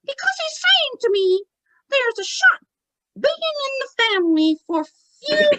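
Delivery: very high ventriloquist falsetto; childlike puppet voice; Tommy. Acted. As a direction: pass on the message as a squeaky spirit. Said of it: "He's like".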